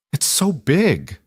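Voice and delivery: high, drawn-out wonder; awed child. A narrator's reading. Young girl seeing dinosaur skeletons for the first time, awed. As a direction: marvel with stretched, rising-then-falling pitch. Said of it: "equally awed".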